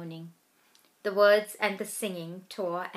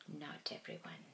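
soft bitter whisper; 4 semitones lower, 17 dB quieter than usual. A narrator's reading. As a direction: breathe it, barely audible.

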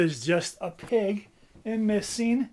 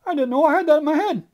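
fast, loud and high; excited rush; 10 semitones higher, 10 dB louder, much faster than usual. Acted. Bird gushing about a surprise idea. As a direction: blurt it out in a rush.

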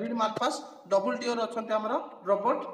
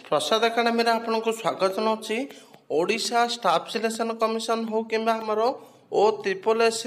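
louder, punchy welcome to the viewers; energetic channel intro; 5 dB louder than usual. Acted. Teacher welcoming exam students, loud upbeat host intro. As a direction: announce the video like a host hyping it up.

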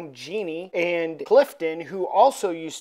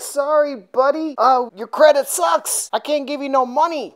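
mock dismissive friends; 8 semitones higher, 6 dB louder than usual. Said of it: "his friends are like".

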